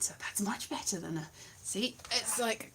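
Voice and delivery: hushed secret aside; whisper. Real, not acted. Secretive aside about hidden Xbox.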